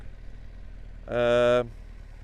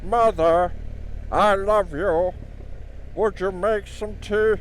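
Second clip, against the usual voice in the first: [name] impression; 5 semitones higher, slower than usual. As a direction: talk like the singer croons, drawn out. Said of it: "I wonder if he talked that at home".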